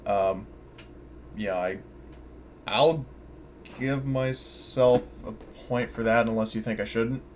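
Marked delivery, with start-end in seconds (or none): none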